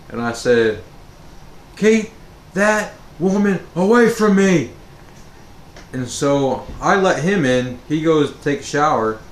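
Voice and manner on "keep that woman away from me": high, loud frightened cry to keep her away; panicked plea. Retold or imitated. Terrified boy begs his dad to keep mom away, high panicked cry. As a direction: beg in terror, voice up high. Scared kid begging dad.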